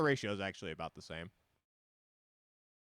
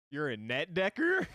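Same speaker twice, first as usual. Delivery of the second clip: voice crack; 6 semitones higher, 6 dB louder than usual. Real, not acted. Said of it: "I haven't had a voice crack in years".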